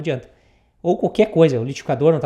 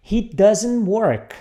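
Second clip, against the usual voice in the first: he doesn't work, said very slowly word by word; slow model sentence; 3 semitones higher, much slower than usual. Shown as a demonstration. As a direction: spell it out slowly for the class to repeat. (bem devagar para o aluno repetir)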